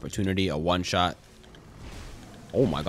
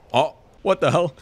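high, loud startled cry; shocked yelp; 9 semitones higher, 8 dB louder than usual. Real, not acted. Gamer caught off guard by a hit, yelping. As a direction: blurt it out in shock.

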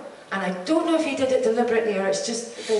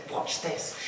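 a gleeful, conspiratorial whisper; mischievous whisper; 4 semitones lower, 9 dB quieter than usual. Retold or imitated. Richard plotting his prank on set, gleeful whisper. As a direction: hush it like a prank about to start.

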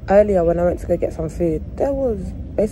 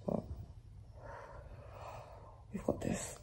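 whisper; 19 dB quieter than usual. Real, not acted. Tired pep talk to viewers.

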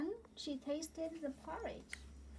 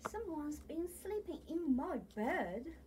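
louder, higher mock-gruff bear voice; growling Papa Bear; 4 semitones higher, 4 dB louder than usual. Papa Bear finding his bed slept in, louder mock growl. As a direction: grumble it like a cross papa bear. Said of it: "growled the Big Papa Bear".

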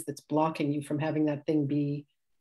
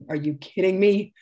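louder, mildly incredulous retort; mock exasperation, pushed out louder; retold exasperated disbelief; 3 semitones higher, 6 dB louder than usual. Reacting to stockings snagged on the chair, mildly exasperated.

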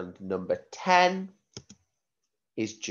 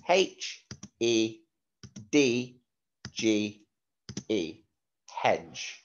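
slow, letter-by-letter spelling; slower than usual. Shown as a demonstration. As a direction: spell each letter clearly for the class.

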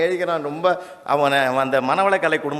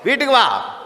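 வீட்டுக்கு வா shouted high and loud; angry mother; 8 semitones higher, 4 dB louder than usual. Retold or imitated. Mother shouts at her son to come home, high loud yell.

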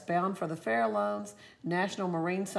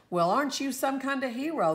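much higher, tender coo to a wet dog; 7 semitones higher, 3 dB louder than usual. A narrator's reading. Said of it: "the old man cooed".

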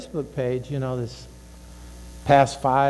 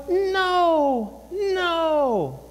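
high inner voice; 12 semitones higher than usual. Acted. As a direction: let the inner voice gently refuse. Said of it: "Because that voice".

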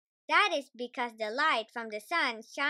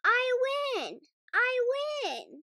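put-on very high, louder little girl crowing; triumphant Peppa; 13 semitones higher, 4 dB louder, slower than usual. A narrator's reading. Peppa gloats to her family, high and loud. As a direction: crow the win like a delighted child. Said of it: "she says".

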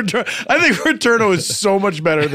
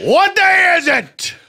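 high, drawn-out mock shout; over-the-top theatrical yell; Al Pacino; 9 semitones higher, slower than usual. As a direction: holler it as a hammed-up Scrooge. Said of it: "he would just shout".

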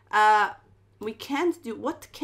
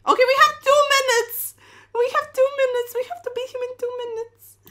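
very high-pitched urgent cry, easing in volume; time-pressure panic; 12 semitones higher, 8 dB louder than usual. Real, not acted. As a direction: panic as the clock runs down. Clock running low in game.

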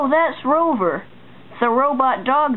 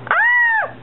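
sharp high yelp. Acted. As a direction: yelp out suddenly. Sudden outburst.

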